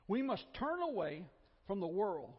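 ordinary delivery

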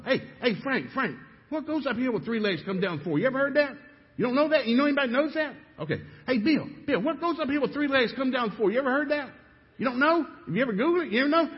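high, loud, rapid pleading into the phone; desperate to find the answer before takeoff; frantic phone call. Retold or imitated. Young businessman calling friends, frantic and pleading.

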